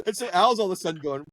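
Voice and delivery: ordinary delivery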